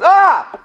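high, loud "Ah!" of surprise; sudden high-pitched startled scream; startled yell. Retold or imitated. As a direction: yell as if scared by a loud noise. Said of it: "he didn't expect me to yell so loud".

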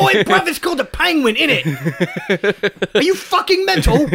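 high, laughing Cockney rant; mock-outraged London lad; British accent. British character, high pitch.

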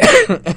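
sudden harsh cough; coughing fit. Real, not acted. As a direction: cough out sharply and loud. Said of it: "excuse me".

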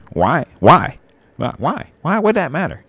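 escalating mock incredulity; mock whiny why. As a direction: parody the clueless askers, pitch climbing high. Mocking people asking why.

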